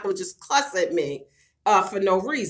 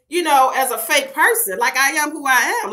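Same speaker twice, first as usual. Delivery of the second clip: ordinary talk, nothing marked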